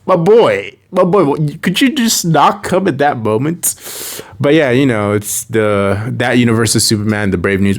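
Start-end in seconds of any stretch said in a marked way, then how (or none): 0.1-3.8 mock exasperation. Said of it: "I'm like".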